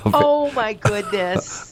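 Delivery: mock shock. Real, not acted. Co-host shocked by swear.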